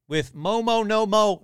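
high, loud, held-note catchphrase; mock slogan. Podcaster testing the episode title, mock proclamation. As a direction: chime it out like a title.

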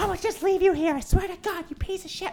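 high shrill mock threat; mom threatening. Mom threatening to leave her son, high mock shriek. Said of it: "But she's like".

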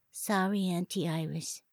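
put-on small, soft child's apology; contrite toddler. A narrator's reading. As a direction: apologise meekly in a little girl's voice. Toddler apologises when prompted.

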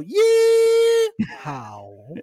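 a prissy, drawn-out high "Yeehaw." with a lift at the end; mock Dallas "Yeehaw.", high and long with a rising tune. Shown as a demonstration. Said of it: "In Dallas, they go".

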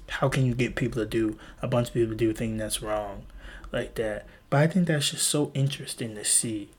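normal talking voice

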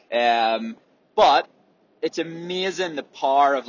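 ordinary talk, nothing marked

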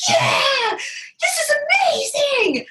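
high-pitched, thrilled squeal of delight; re-enacted elated outburst; elated cry. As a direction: cry it out, giddy with amazement. Retells own elation during contractions.

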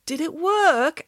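high, hopeful question; hopeful lift in the voice. A narrator's reading. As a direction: ask with lifted, eager hope. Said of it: "asked Derek hopefully".

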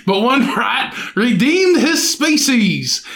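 put-on British accent drifting into Australian; slow, exaggerated British-Australian accent; badly faked British newsreader accent; mock British accent. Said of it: "Now I'm getting an Australian".

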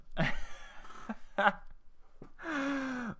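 long, high wheezing laugh; real laughter. Real, not acted. Reviewer breaking up after the Texan reading, real laughter. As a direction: dissolve into laughter at your own silliness. Cracks up at own accent again.

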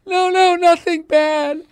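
very high, slow, loud mock wail; hysterical crying. Wife reassuring husband about a movie she watched, hysterical crying. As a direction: imitate blubbering through an explanation, dragging each word out. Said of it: "she was crying hysterically".

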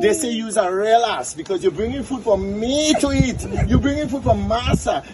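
high-pitched pig tirade, piling up the accusations; berating pig. Acted. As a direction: rail at the donkey in a high pig voice. Pig berates donkey in tale.

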